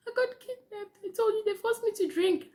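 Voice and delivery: high, whiny fake sob; a drunk teen fake-crying her excuse; mock crying. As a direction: pretend to cry to dodge blame. Lying, half crying.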